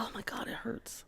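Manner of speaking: whisper, wincing; whispered pain. Real, not acted. Cracking her neck mid-show, whispered wince. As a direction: breathe out the pain. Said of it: "I have to crack my neck".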